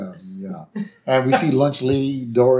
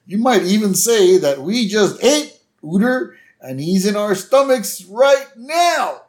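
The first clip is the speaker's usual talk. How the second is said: rising to a high, slow mock shout; carried-away gloating; 9 semitones higher, 4 dB louder than usual. Principal hinting too far to kids, building mock gloat. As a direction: build louder and higher, drawing out the end.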